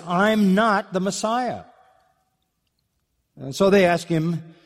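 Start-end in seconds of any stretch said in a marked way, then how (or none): 0.1-1.6 slow, higher, leaning hard on "not"; emphatic denial. Retold or imitated. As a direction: stress "not" in "I'm not the Messiah", drawn out and firm. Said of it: "And he emphatically says".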